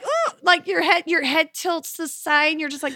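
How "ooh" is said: high, surprised ooh; a curious little ooh; mock startled ooh. Mom acting annoyed passenger reacting, high ooh.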